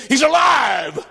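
preaching shout. Acted. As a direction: ring it out like an Easter proclamation. Climax of quoted Paul.

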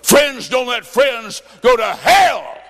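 preacher crying out to the congregation; preaching shout. Real, not acted. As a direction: belt it like a revival call. Preaching climax.

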